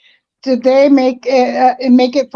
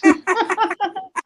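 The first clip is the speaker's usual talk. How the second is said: high laugh; 8 semitones higher, 6 dB quieter than usual. Real, not acted. Laughing at the trolling.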